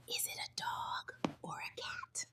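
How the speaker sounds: hushed whispered question. Acted. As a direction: whisper the question to little viewers. Whispered take of repeated quiz question.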